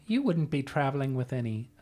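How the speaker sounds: a lightly voiced innkeeper turning sweet; sweet Jenny. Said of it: "Jenny says".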